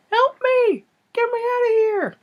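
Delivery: very high falsetto plea; squeaky mock cry for help; playing the body's voice. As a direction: fake a trapped voice in falsetto. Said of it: "make it seem like he's the voice".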